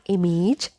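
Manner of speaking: "image" ending in a long stretched vowel, like im-AYJ; mispronounced "image". Shown as a demonstration. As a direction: say "image" with a full vowel in -age. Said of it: "correct pronunciation of this word is not".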